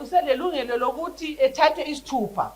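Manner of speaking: usual speaking voice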